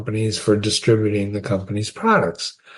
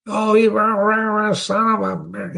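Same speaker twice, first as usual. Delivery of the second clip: imitated cursing; 8 semitones higher than usual. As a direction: parody a boss swearing like a sailor. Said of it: "just swearing like a trooper".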